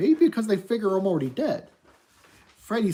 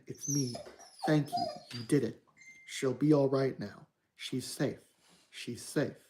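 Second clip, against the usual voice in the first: warm, gentle woman; 2 semitones lower, 6 dB quieter, slower than usual. Acted. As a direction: comfort him gently, almost hushed. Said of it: "a warm, gentle one".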